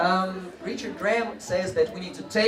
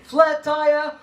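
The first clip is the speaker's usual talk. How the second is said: loud call; 9 semitones higher, 8 dB louder than usual. Shown as a demonstration. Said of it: "the one I like".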